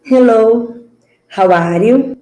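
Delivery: usual speaking voice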